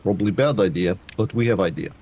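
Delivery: lightly put-on, hammy Eastern European accent; mock Eastern European accent for the chat, barely pushed; playful, half-hearted Eastern European accent; bad Eastern European accent. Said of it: "Central is wanting to hear bad Eastern European accent".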